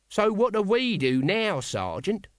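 high, drawn-out guardsman's question; uncertain Nobby. A narrator's reading. Guardsman asking his sergeant what comes next, uncertain. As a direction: ask it high and slow, at a loss. Nobby, figure in narrated story.

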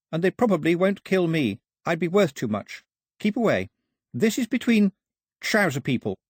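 put-on high-pitched girl's urgent warning; high voice, pressing the accusation; Daphne, urgent. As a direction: warn them in a high, insistent voice.

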